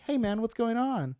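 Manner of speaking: high, loud, goofy impression of a buddy; friend's phone voice. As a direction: answer the phone as a hyped-up pal. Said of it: "answer the phone for someone".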